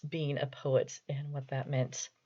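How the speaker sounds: normal talking voice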